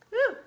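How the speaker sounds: short, sharp "woo"; whoop. Real, not acted. Cook finishing onion chopping, sharp whoop. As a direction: let out a quick whoop.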